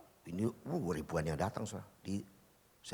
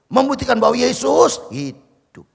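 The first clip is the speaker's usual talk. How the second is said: loud, slow and high; preacher trumpeting that Jesus lives, loud and slow; triumphant proclamation; 13 semitones higher, 15 dB louder than usual. Acted. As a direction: proclaim it as victory. Climax of the healing testimony.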